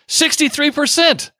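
high-pitched astonished announcement; amazed reveal. Real, not acted. Host revealing to author a surprising study result, amazed. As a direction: deliver the figure high, as a punchline.

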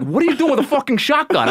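high, fast, laughing disbelief; incredulous cop. Retold or imitated. Airport cops questioning the hunter, high fast disbelief. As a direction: demand it like a baffled cop.

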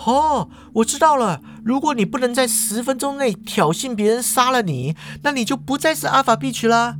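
high excited realization; light up with a bright idea; sudden idea. A narrator's reading. Yefeng realizes the escape plan, high excited insight. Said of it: "灵光一现".

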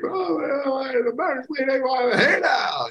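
高亢激昂的棒球转播喊叫; home run call. Retold or imitated. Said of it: "and I'm like".

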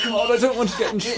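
high, very fast fretting; nervous panic. Acted. Salesman fretting to the wizard, high and rushed. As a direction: gabble it out in a fright.

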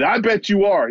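imitating him insisting with rising heat; mock fury, pitched well up; angry retort. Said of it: "lashing out at me".

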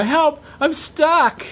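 lady on the balcony shouting down to passing runners, mock high cry; imitating a stuck lady. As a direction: squeal it like a woman locked out on her balcony. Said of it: "and she go".

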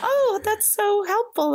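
high, sarcastic sing-song mockery. Mocking unhelpful advice-givers, sarcastic and high. Mocking people's fake helpfulness.

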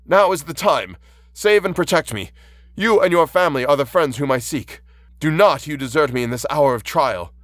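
impassioned, pleading outcry; desperate cry. A narrator's reading. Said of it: "I cried".

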